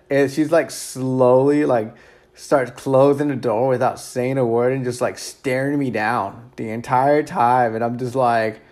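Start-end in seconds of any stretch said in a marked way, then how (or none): none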